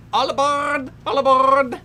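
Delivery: loud rolling conductor call of all aboard; imitating a train conductor's call. Commentator mimics the movie conductor calling passengers, loud. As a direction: bellow it like the train conductor in the film. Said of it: "He's always rolling his R's a lot".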